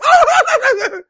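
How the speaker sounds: high-pitched babbled cry; mock wordless outcry. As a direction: burst into a comic high wail. Acts out the professor's reaction.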